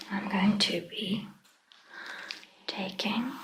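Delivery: ordinary delivery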